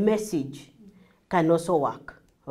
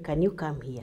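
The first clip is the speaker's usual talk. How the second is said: low, soft, gentle asking; soft request; 6 semitones lower, 7 dB quieter than usual. Shown as a demonstration. Teacher shows a different tone, soft request. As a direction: drop pitch and volume to ask. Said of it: "not the same as saying".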